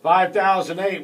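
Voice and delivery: big on-air plug for the phone line; radio announcer. Acted. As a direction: sell the call-in number like a station promo. Host announcer read, loud.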